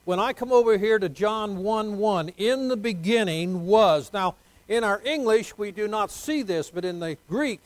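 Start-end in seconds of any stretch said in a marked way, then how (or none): 2.4-4.1 slow, stressed "was" falling; slow emphatic quote. Shown as a demonstration. Pastor quotes John one one, slowing to stress was. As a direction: hold each word, land on "was". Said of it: "it's in the continuous past tense".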